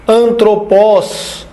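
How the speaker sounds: "antropos" slowly, syllable by syllable; syllabified demonstration. Shown as a demonstration. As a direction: draw out each syllable of "antropos". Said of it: "Quando a gente vai falar de silabação". ("antropos" devagar, sílaba por sílaba)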